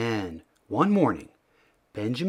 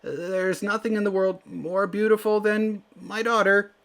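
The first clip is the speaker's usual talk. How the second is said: high, proud shout over the shoulder; 9 semitones higher than usual. Acted. Fleeing father shouting about his daughter's beauty. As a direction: boast of his daughter while riding away. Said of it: "shouted the man".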